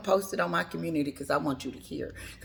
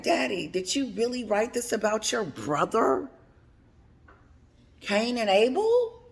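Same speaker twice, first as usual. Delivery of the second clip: rising to a loud, laughing-crying, much higher "hello"; kids' mock outrage; 6 semitones higher, 7 dB louder than usual. Harry's kids confronting their father, in laughing mock outrage. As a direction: play the stunned child, breaking into laughter.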